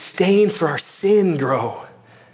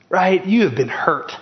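ordinary delivery

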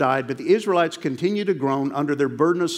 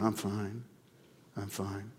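softer, mumbled sad-sack "I'm fine"; mopey fake fine; 5 semitones lower, 14 dB quieter, slower than usual. Churchgoer answers a greeting, softer and mopey. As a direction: droop like Eeyore while insisting you're fine. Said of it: "you'll lie through your teeth".

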